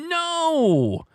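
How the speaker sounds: high, drawn-out falling no; emphatic rejection. Acted. Host rejecting the guess of S, drawn-out rejection. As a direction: hold it high, then swoop down.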